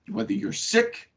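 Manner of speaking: ordinary talk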